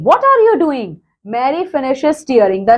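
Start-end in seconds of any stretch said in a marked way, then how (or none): 0.0-1.0 shouting. Retold or imitated. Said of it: "Barry shouting".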